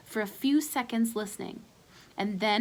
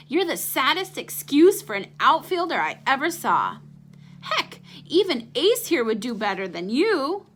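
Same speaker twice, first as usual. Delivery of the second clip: bully taunt; 6 semitones higher, 5 dB louder than usual. Acted.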